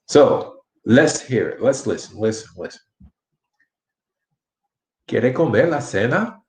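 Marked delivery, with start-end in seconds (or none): 5.1-6.4 "¿Quiere comer la cena?" as a question, tune climbing on "cena"; question intonation. Shown as a demonstration. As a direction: ask "¿Quiere comer la cena?" with "cena" rising at the end. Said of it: "Can you hear the different inflection".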